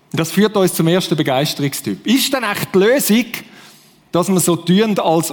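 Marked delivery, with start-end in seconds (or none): none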